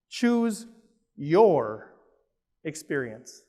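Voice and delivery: Choose, your, experience spaced apart with long pauses; each word separated. Shown as a demonstration. Stating the first principle to the room, one word at a time. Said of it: "spaced-out slogan".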